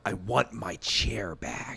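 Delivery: low, soft, very slow demand; contained anger. Retold or imitated. Demanding his chair from the stranger, slow and contained. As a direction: spell it out slowly, holding back.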